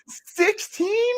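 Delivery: a high, incredulous cry of the number; can't believe the ranking, voice shooting up; stunned disbelief. Real, not acted. Shocked at ASU's low ranking.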